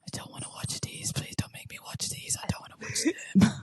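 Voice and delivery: whispered, whimpering mock plea; whimpered plea. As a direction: beg in a tiny, tearful whisper. Acting out dread at watching reels.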